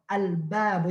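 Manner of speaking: ordinary talk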